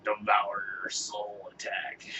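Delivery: slow, strained mock monster roar; hammed-up monster announcing its move; demon Pokemon. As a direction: growl it like a demon attack call. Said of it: "he's like".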